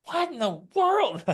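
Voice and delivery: high, exaggerated what-in-the-world; baffled disbelief. Husband retells his baffled reaction to crying over dinner, high mock disbelief. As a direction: throw the voice up in mock bewilderment.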